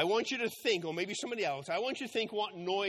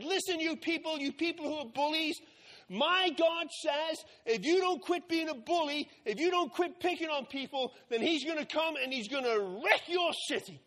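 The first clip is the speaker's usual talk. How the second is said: higher, raised prophet's warning to the city; Jonah preaching; 6 semitones higher than usual. Acted. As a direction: call out to the crowd like a street preacher.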